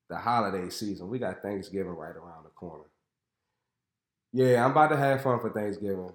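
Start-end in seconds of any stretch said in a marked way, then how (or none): none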